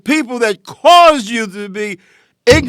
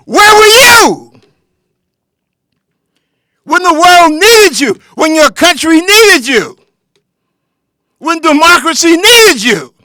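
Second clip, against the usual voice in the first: high, loud, rising shouts of reproach; angry shouting; 4 semitones higher, 4 dB louder than usual. Real, not acted. Preacher confronting voters who stayed home, shouting. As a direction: hurl the question at them again and again.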